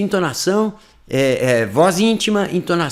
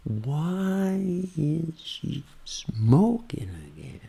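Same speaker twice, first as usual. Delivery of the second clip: whispered, very slow start on "Why is she"; train effect; 8 dB quieter, much slower than usual. Shown as a demonstration. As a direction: drag the first words, then speed up. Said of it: "É esse o efeito trem". (efeito trem: começo arrastado, sussurrado)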